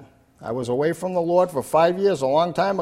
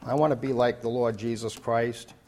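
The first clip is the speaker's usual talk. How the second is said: ordinary delivery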